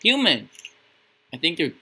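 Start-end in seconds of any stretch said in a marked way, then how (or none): 0.0-0.5 sharp high yell of surprise; startled cry. Real, not acted. Gamer spotting a human in the dark, startled yell. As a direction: blurt it out, startled. Gamer spots a figure in game.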